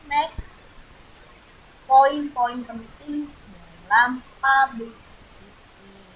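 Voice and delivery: slow, loud announcing of the heading; drawn-out loud headline read; announcer style. Shown as a demonstration. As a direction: present the heading like a title card. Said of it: "announcing section heading".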